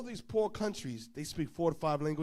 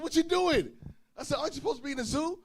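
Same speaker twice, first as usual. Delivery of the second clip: amazed questions; 9 semitones higher, 5 dB louder, faster than usual. Retold or imitated.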